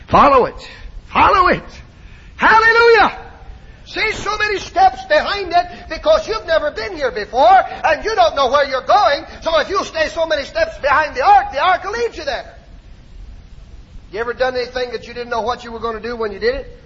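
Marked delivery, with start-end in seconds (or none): 0.1-3.2 high, loud pulpit cries; short exultant shouts climbing higher; preacher's shout. Real, not acted. Preacher calling out to congregation. 3.9-12.5 high-pitched sermon delivery; high, insistent preacher cadence; fervent preaching. Acted.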